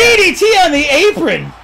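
high, loud play-by-play shout; excited call. Real, not acted. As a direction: yell the move like a hyped commentator. Excited call of game move.

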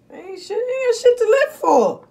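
high, loud, slow ranting cry; slow exasperated yell; exasperated shout. Real, not acted. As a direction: throw it out high and slow in disgust. Ranting about the Matrix.

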